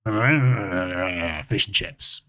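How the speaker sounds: low, drawn-out mumbling burble; Boris. Acted. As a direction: mumble it slowly like a bumbling politician. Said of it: "pretending to be Boris".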